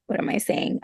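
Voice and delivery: breathy unvoiced aside, barely audible; self-correcting mutter. Real, not acted. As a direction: whisper it under your breath.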